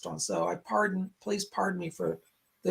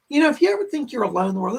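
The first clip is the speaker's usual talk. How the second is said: quick, rushed and a bit louder; fast; 4 semitones higher, 5 dB louder, much faster than usual. Real, not acted. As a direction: rattle it off eagerly. Repeats the line for everybody.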